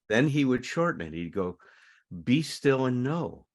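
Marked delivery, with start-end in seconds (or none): 2.2-3.5 slow, raised, intoning the line gently; a hint of a teacher leading a still moment; meditation line. Teacher leading the circle, slowly intoning the meditation line.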